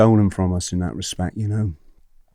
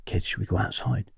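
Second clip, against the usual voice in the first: soft, hushed suggestion to the class; conspiratorial; 9 dB quieter than usual. Retold or imitated. Teacher quietly tempting kids out of a strict classroom, conspiratorial. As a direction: quietly float a secret plan.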